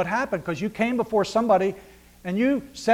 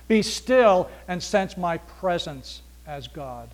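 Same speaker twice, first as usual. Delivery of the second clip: slow solemn; much slower than usual. Acted. Slow meditative scripture paraphrase.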